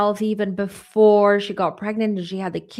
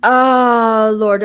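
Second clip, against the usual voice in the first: emotional; 3 semitones higher, 10 dB louder than usual. Real, not acted. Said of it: "I'm sorry if I get emotional".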